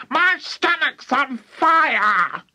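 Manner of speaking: high, drawn-out screech; sudden shout. A narrator's reading. Grandma after swallowing the medicine, shouting. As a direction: wail it slowly at a very high pitch. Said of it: "she shouted suddenly".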